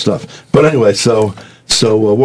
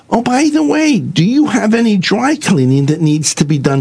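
church lady voice; 11 semitones higher than usual. Retold or imitated. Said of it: "some lady would come up and say".

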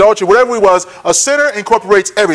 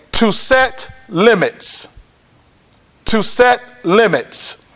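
drawn-out words, spaced apart; weighty, unhurried teaching point; slow, deliberate definition; much slower than usual. Real, not acted. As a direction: let each word land slowly. Key definition after a build-up.

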